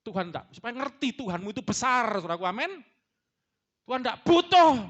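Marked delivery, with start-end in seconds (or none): 3.8-4.8 loud, high burst on butuh; preaching shout. Real, not acted. Preacher stressing to the congregation, loud and high. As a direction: hammer home the point.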